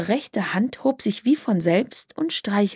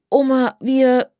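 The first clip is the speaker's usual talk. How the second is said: higher, louder, drawn-out attempt; anxious pleading; 5 semitones higher, 5 dB louder than usual. A narrator's reading. Sprotte tries explaining to her grandmother, anxious. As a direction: start to explain, then break off.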